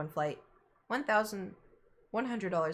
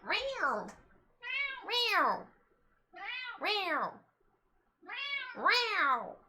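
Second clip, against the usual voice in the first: higher, long meows; cat meow; 11 semitones higher, much slower than usual. Acted. Imitating the cat again.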